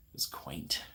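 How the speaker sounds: It was quaint breathed in a whisper; a half-whispered private joke; whispered aside. Real, not acted. Host whispering an aside to viewers.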